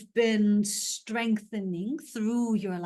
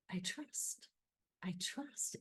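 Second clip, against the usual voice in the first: much softer, almost breathed to yourself; hushed affirmation; 15 dB quieter than usual. Shown as a demonstration. Coach modeling a hushed inner affirmation, much softer. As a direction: murmur "I trust" inward like a vow.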